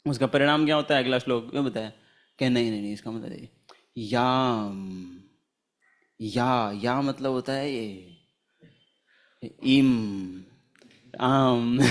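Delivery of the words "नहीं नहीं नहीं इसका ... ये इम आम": a lightly voiced man who slowly breaks the verse wrongly, याम then इम, आम; mimicking wrong reading. Mocking the train man who split याम इमाम into इम, आम. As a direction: drag out the syllables like a fumbling reader, lower than usual. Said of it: "ऐसे ही बोल रहा था वो".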